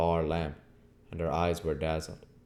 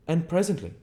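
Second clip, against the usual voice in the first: higher, curt stranger; stranger's voice; 12 semitones higher, 6 dB louder than usual. Acted. Stranger answering Henfrey, higher curt voice. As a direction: play the aloof stranger, pitched up.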